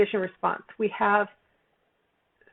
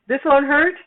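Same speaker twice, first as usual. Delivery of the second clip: higher, louder sing-song promise; mock reassurance; 6 semitones higher, 7 dB louder than usual. Teacher mocking the nurse's promise, higher and louder. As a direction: tease the phrase as a warning sign. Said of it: "So if it says".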